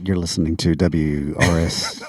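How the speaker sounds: slow, lazy student radio DJ; college DJ voice. Retold or imitated. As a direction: drag through a station ID. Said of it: "That was my college D J voice".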